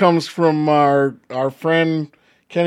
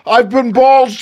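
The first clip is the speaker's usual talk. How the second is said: higher, louder bad Kiwi accent; Kiwi accent attempt; 6 semitones higher, 5 dB louder than usual. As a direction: attempt New Zealand, about to crack up. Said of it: "It has to be a Kiwi accent".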